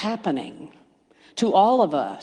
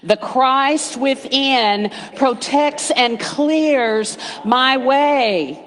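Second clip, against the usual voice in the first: slow, louder, higher proclaiming; bold affirmation; 5 semitones higher, 4 dB louder, much slower than usual. Shown as a demonstration. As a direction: declare it with conviction. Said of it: "Say it with me".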